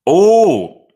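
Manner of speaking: a sudden loud, high "Oh"; surprised gasp. Real, not acted. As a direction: caught off guard by the sight. Sees Messi's trophies laid out.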